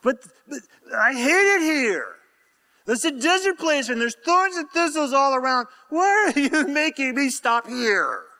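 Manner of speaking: high, hammed-up childish complaint; whining protest. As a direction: stammer into a mock whiny tantrum. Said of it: "a part of you is going".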